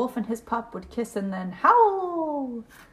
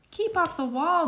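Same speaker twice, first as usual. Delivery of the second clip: ordinary delivery, nothing marked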